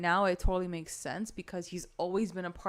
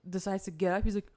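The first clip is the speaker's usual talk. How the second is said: normal talking voice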